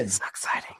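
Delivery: dry whispered sarcasm; sarcastic whisper. Pretend. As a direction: hush it, deadpan mock excitement. Sarcastic about cleaning weekend.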